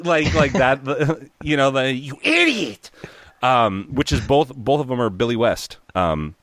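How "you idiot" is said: high cartoon chihuahua snap; Ren voice. Fan imitating a cranky cartoon dog, high. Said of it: "I can't really do the voice".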